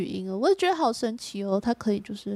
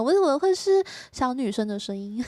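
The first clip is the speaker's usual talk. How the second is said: ordinary talk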